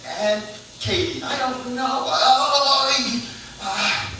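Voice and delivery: high, loud, frantic game-show shouting; game show grandma. Retold or imitated. Grandma and family as a shrieking game show.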